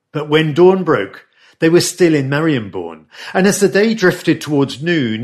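ordinary talk

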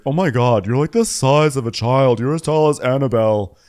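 mock gushing. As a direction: imitate an over-the-top compliment. Quoting gushing cast member posing.

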